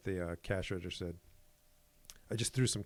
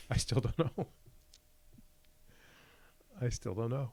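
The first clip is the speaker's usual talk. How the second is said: laughing, higher-pitched admission; amused bafflement; 3 semitones higher than usual. Real, not acted. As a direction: laugh helplessly while admitting defeat. Laughing over his own failure.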